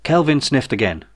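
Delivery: ordinary delivery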